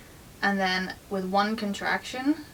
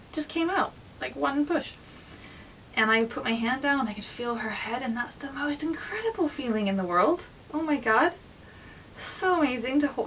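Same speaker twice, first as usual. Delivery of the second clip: higher, breathless with wonder; awed; 5 semitones higher than usual. Real, not acted. Mother reliving her baby's head crowning, higher with awe.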